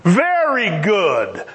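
lightly voiced God, slow and low; God's satisfied verdict. God looking over everything he made, slowly approving. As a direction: savor each word of approval.

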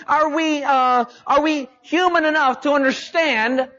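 usual speaking voice

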